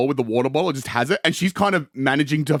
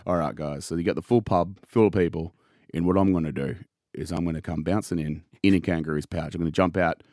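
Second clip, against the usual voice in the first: lightly voiced Hogan pitching a scene; 7 semitones lower, 3 dB quieter than usual. Hogan pitches his kangaroo-pouch entrance to the crew, laid-back and low. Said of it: "His original pitch was".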